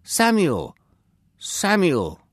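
high, loud call of a name. A narrator's reading. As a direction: call out to a sleeping boy. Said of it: "he heard a voice calling".

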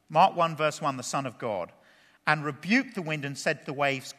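ordinary talk

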